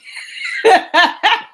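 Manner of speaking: loud laugh. Real, not acted. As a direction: burst of high laughter. Laughing at the reader.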